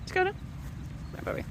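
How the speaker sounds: whispered call to a puppy; whispering to a dog. Real, not acted. Calling dog softly.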